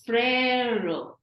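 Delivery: "frère" with a long open eh; stressed A sound. Shown as a demonstration. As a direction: draw out the vowel of "frère". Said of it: "stressing on the A sound".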